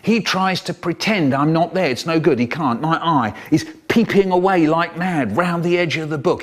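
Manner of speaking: usual speaking voice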